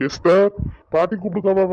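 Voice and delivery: loud, high summons to another room; calling out. Acted. Calling Esther to grandmother.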